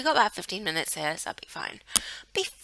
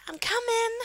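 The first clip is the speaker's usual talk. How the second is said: put-on much higher, squeaky little child calling; little girl voice; 3 dB louder than usual. Little Sophia calling after her family, high childlike voice. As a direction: play the tiny kid running to catch up. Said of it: "She's like".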